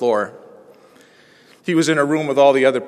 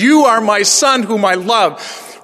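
slow, lifted preaching of the verse, much higher and louder; preached verse; 8 semitones higher, 5 dB louder, slower than usual. Acted. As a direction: lean into each word as good news. Preaching the text.

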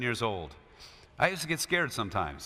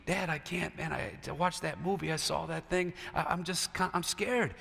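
softer, small pleading voice; scared child; 2 semitones lower, 9 dB quieter than usual. Retold or imitated. Little boy whispering his fear to his dad. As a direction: plead quietly like a frightened boy. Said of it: "Dad come up and he said".

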